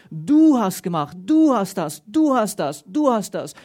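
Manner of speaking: "du" hit hard at the start of each phrase; stress on du. Shown as a demonstration. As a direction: hammer the word du every time. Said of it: "wie er die Betonung auf du legst".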